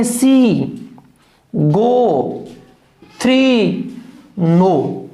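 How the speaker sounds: "सी, गो, थ्री, नो" each lengthened, with a pause between; drawn-out example words. Shown as a demonstration. Teacher listing open-syllable examples, "सी, गो, थ्री, नो" each drawn out. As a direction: say "सी, गो, थ्री, नो" singly, holding each final vowel. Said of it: "जैसे अपने यहां बात करें".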